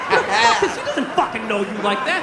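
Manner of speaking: ordinary talk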